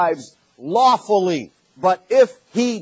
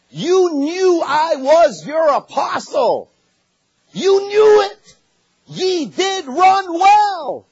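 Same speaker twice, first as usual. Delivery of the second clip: impassioned preaching; 8 semitones higher than usual. Acted. Preacher voicing Paul's rebuke, rising.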